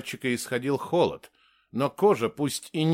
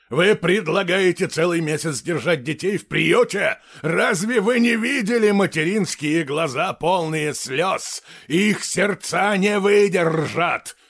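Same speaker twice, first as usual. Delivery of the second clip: loud, raised, impassioned outburst; angry shouting; 6 semitones higher, 6 dB louder than usual. A narrator's reading. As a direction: shout the accusations, building to a drawn-out finish. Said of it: "Он сорвался на крик". (срываясь на гневный крик)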